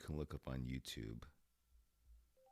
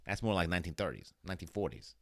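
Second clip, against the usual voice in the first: rapid-fire fifties patter, raised voice; fast old-movie talk; 4 semitones higher, 5 dB louder, much faster than usual. Shown as a demonstration. Showing how fifties people talked, fast old-movie delivery. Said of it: "Talking like a fast nineteen fifties person".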